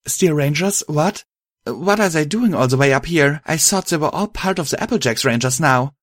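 sudden concern, voice lifted. A narrator's reading. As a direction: ask it in worried disbelief. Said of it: "suddenly appearing very concerned".

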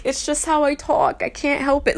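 lightly defensive; defensive retort. Retold or imitated. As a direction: protest it a little, retelling your own reply. Own past reply, protesting.

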